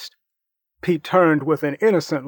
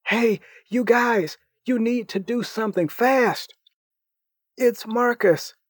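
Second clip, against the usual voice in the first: put-on higher, urgent young voice; a higher, hurried teen voice; frantic boy Harry; 7 semitones higher than usual. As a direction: burst in with bad news. Harry runs in with news.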